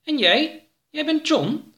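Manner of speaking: small, high-pitched alien. Acted. Alien Oroka meets John.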